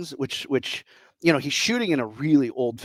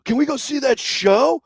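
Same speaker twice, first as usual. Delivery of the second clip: much higher, pleading excitement; eager; 10 semitones higher, 4 dB louder than usual. Retold or imitated. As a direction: beg like an excited kid. Asking eagerly after the movie.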